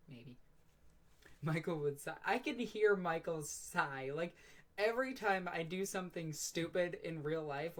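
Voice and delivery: ordinary delivery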